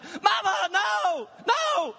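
mimicking a toddler's frantic no no; panicked child scream. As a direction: scream like a small boy who thinks the knife is coming. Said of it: "screaming bloody murder".

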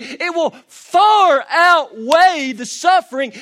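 high, loud and slow, leaning on far; drawn-out preached shout; shouted preaching climax. Acted. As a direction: thunder the promise to the room.

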